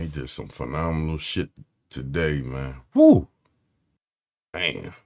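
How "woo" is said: loud high whoop; excited whoop. Real, not acted. Streamer cheering a friend's crazy feat, loud whoop. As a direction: let out a big celebratory woo.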